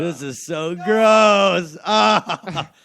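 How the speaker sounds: slow, loud, disgusted yell; disgusted shout. Real, not acted. Disgusted at spit cup.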